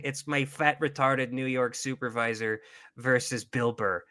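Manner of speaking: usual speaking voice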